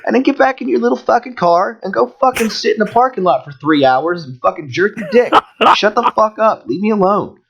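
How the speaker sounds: high-pitched, fed-up tirade; retold angry screaming. Driver retelling how he told off the cop, furious. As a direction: re-enact telling someone off, pitch way up. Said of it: "when I started fucking screaming at".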